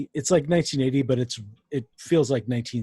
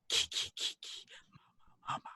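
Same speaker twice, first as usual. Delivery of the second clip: creepy whispered chant; 11 dB quieter, faster than usual. Retold or imitated. Imitating the horror sound effect.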